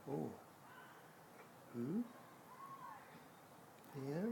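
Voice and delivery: soft, high, tender coaxing. Real, not acted. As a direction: coax a frightened bird gently. Coaxing the stunned bird softly.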